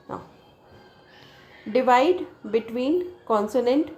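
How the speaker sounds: ordinary talk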